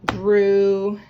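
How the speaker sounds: "Grew" said loud with a long oo; sounded-out word, loud. Shown as a demonstration. Teacher models the oo sound in "Grew" for the class. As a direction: stretch the oo in "Grew". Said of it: "I'm seeing my mouth do the O".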